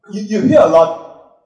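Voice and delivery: ordinary talk, nothing marked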